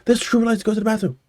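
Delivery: high, fast recruit bark; barked sir yes sir. Retold or imitated. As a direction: rattle it off at attention. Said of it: "They're like".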